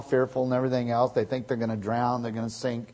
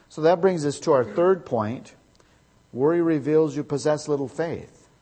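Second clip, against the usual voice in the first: fiery preaching; 3 semitones higher, 5 dB louder than usual. Acted. Preaching the third point loudly.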